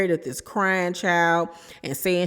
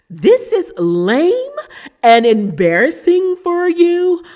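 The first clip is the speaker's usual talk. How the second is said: acted yelling; 9 semitones higher, 7 dB louder than usual. Meredith telling off Angie at the table, acted yelling. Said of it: "She was yelling".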